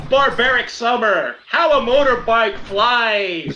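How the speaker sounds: high, slow, declaimed verse; dramatic haiku reply. Acted. Warrior replies to the elder mind with haiku, declaiming. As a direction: fire back a poem with grand delivery. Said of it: "I reply with".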